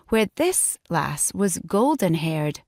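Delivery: usual speaking voice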